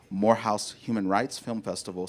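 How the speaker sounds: ordinary talk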